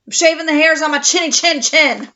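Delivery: mock yell of an annoyed pig; yelled back. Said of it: "He yelled back".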